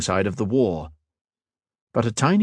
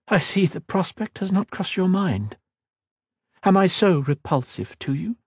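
a hint of a proud queen, raised a little; regal queen, lightly voiced; 11 semitones higher than usual. Queen questioning the mage, lightly voiced regal. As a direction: speak as a wary, commanding queen.

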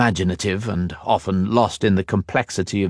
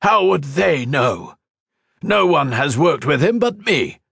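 higher, louder protest; indignant; 8 semitones higher, 3 dB louder than usual. A narrator's reading. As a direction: push back hard. Tamar indignant.

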